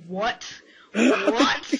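mock disbelief, rising at the end; imagined baffled dog. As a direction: play a startled dog, building to a loud what. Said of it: "The dog's like".

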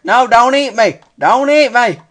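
high, loud mock-terrified plea; panicked pleading. Player begging a game monster, panicked high pleading. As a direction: cry out to be spared.

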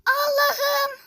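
high, loud wailing cry to God; loud anguished wail. Real, not acted. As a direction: wail it out in despair. Gamer wailing at game.

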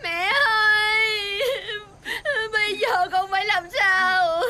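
slow, wailing cry with long-held vowels; bawling. Acted. Daughter bawls to mother.

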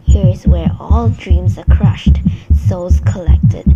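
loud, low-pitched menacing villain; evil villain. A narrator's reading. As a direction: boom it low, lording over your captive. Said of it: "The voice chuckled evilly".

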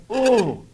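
high swooning ooh; a silly blissful ooh; mock ooh. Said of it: "oohed Biggles and Algy".

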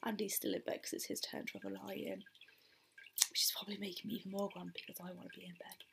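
whispering. Real, not acted. Hiding from kids, early morning.

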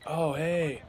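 nonchalant cousin. Retold or imitated. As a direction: shrug it off like nothing happened. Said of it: "and he's like".

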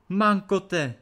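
high, loud exclamation; startled cry. A narrator's reading. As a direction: cry out in alarm. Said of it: "vykřikla Anče".

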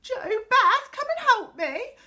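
high, loud, despairing wail; sobbing plea. A narrator's reading. Inky girl calls her friends to help, sobbing. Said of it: "sobbed Connie, crying tears of anger and despair".

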